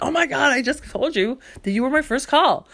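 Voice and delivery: can't believe she's asking again; exasperated outburst. Retold or imitated. To the librarian on the phone, an exasperated high cry. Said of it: "I'm like".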